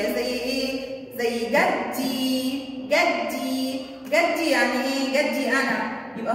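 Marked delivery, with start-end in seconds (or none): 1.4-2.7 جدي with a long, stretched final ee; drawn-out possessive ya. Shown as a demonstration. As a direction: stretch the ending of جدي very slowly. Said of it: "ياء ملكية".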